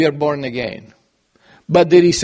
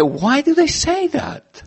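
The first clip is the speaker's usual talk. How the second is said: high, slow wondering question; a sudden puzzled why; puzzled self-question; 10 semitones higher, slower than usual. Retold or imitated. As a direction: ask it much higher and slower, puzzled. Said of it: "suddenly I read the scripture and said".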